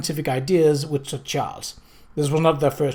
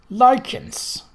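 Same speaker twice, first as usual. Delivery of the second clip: higher, louder, rising scornful "Lichens"; scoffing; 8 semitones higher, 4 dB louder than usual. A narrator's reading. Marston mocks lichens in a high, scornful rise. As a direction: sneer at the very word.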